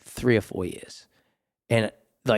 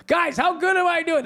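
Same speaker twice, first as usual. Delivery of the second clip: showing off; 3 dB louder than usual. Retold or imitated. Showing off to mother on phone.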